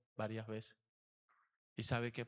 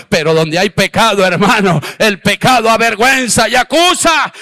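fiery preacher building to a roaring climax, higher and louder; preaching at full force; 4 semitones higher, 26 dB louder than usual. Acted. Pastor preaching his testimony to the congregation, rising to a fiery shout.

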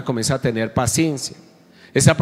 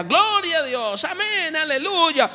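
much higher, slow, jubilant praise cries; joyful praise; 14 semitones higher, slower than usual. Retold or imitated. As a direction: imitate the joyful churchgoer lifting up praise. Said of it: "cómo llegaba en alegría".

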